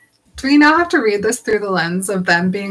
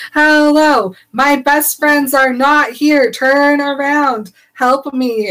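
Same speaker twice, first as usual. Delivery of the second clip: slow, louder, higher, pleading hard; hammed-up panicked Hermione begging the conductor; teen begging the train conductor to turn around, hammed-up and loud; mock frantic demand; 5 semitones higher, 3 dB louder, much slower than usual.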